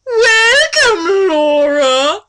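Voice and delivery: high, loud hammed-up greeting; villain voice. Dark Lord greeting Laura from the fire, high and loud. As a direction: ham up an evil welcome.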